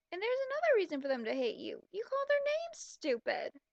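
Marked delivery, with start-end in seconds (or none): 1.9-3.6 high, slow mock of the writer. Reader mocks the fanfic's heroine in a high, slow voice. Mocking reading the fanfic character.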